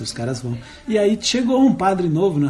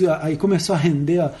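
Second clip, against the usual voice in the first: ordinary talk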